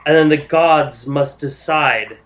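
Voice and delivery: measured, weighty divine address; stately speech with deliberate pauses; solemn goddess. Acted. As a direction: pronounce it like a goddess setting a hard choice. Game master voices goddess Sehanine addressing group.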